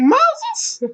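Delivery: put-on very high-pitched questioning old lady; put-on high older woman's voice, rising; older neighbor woman. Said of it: "She's a little older".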